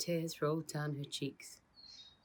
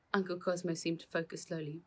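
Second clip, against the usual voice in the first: ordinary delivery, nothing marked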